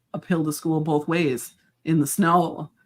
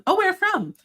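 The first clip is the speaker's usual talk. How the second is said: much higher, nosy small-town voice; mock townsperson; slower than usual. Locals quizzing a newcomer, high mock voice. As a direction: sing it out like a curious neighbor. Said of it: "is what I was saying".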